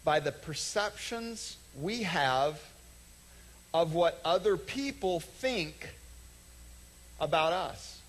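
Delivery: slow, drawn-out stress; slow it down so they catch it; deliberate slowed delivery; slow emphasis. Real, not acted. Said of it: "listen carefully".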